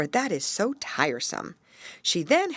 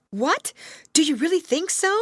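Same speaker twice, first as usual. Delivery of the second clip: higher, louder, fast eager question; excited cry; 11 semitones higher, 4 dB louder than usual. Acted. Gerda cries out in hope to the raven. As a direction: rush it out, full of hope. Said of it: "cried the little girl".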